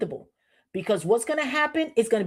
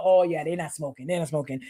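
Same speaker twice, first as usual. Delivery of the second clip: 1 lightly voiced locals; 6 semitones lower, 3 dB quieter than usual. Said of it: "they know".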